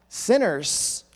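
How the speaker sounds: normal talking voice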